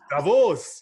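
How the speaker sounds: Davos said loud and firm as the correct model; model pronunciation. Shown as a demonstration. Correcting co-host's attempt at the town, emphatic model Davos. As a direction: give Davos emphatically, falling at the end. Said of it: "The emphasis on the first syllable".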